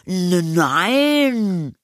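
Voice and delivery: put-on high, long drawn-out boy's denial; stammer, then stretch the word high; indignant. A narrator's reading. Anton denies mother's accusation, indignant. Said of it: "rief Anton empört".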